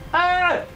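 high-pitched playful falling scream; comic yelp of mock terror. Gamer fears dying in game, high mock scream.

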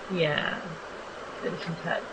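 ordinary delivery

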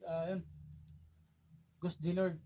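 normal talking voice

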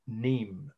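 "Nîmes" said as "neem", the S silent; "Nîmes" in one syllable, ending on m; French pronunciation. Shown as a demonstration. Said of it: "You don't pronounce the S".

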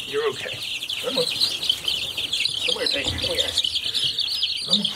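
normal talking voice

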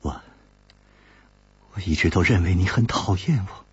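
put-on gentle young woman musing aloud; slow, lingering woman's voice. A narrator's reading. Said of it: "悠悠地说".

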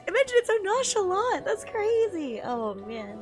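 usual speaking voice

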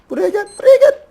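very high, loud mock voice calling them over; squeaky come-here. Luring opponent, squeaky voice. (çok ince, yüksek sesle alaycı çağırma)

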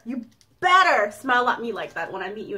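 ordinary talk

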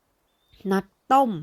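loud, slow outraged cry; high cry. A narrator's reading. Girl outraged at being called a swindler, loud cry. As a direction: cry out the word in offended shock. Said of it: "เฟรินร้องเสียงสูง".

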